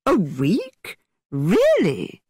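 hammed-up astonished gasp of a question; excessive surprise. A narrator's reading. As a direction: overplay the surprise like a stage actor. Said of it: "with excessive surprise".

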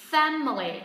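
"Family" with loud FAM, then falling; stress on first syllable. Shown as a demonstration. Teacher models for learners, strong first-syllable stress on "Family". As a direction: punch FAM in "Family", drop the rest. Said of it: "Trọng âm rơi vào âm tiết thứ nhất".

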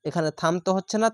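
usual speaking voice

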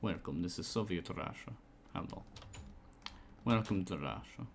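a hammed-up, hushed Russian accent welcoming you; playing a Russian host in a low, soft mock Russian accent. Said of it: "Is this Russian?".

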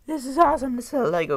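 high, loud delight; excited exclamation. Real, not acted. Collector thrilled with a Lego piece, high excited cry. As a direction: burst out, thrilled by the find.

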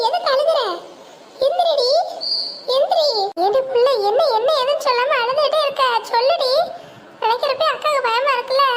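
put-on anxious older sister demanding an answer; alarmed urging. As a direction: press her urgently, pitch well up. Sister alarmed at Mullai crying.

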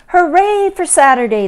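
high, loud hooray; cheering. A narrator's reading. Mother and child celebrate Saturday, jubilant cheer. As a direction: cheer it out for the whole crowd. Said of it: "they cheered".

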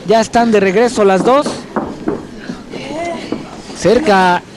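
steady ringside play-by-play; wrestling commentator. Real, not acted. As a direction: call the match live from ringside. Live lucha libre commentary.

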